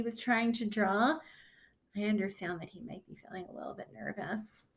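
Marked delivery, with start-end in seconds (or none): 1.9-4.7 much softer, quick, gentle aside; soft reassurance. Real, not acted. Reader reassures about Nico's nerves.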